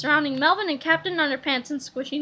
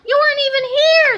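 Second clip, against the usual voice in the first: put-on high, loud kid protest; indignant shout; 11 semitones higher, 8 dB louder than usual. A narrator's reading. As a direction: call him out loudly. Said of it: "said George".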